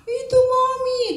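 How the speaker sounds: put-on high, slow, whimpering little boy; crying child. Boy answering his mother while crying, high whimper. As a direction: sob out the words like a small boy. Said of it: "katanya sambil nangis".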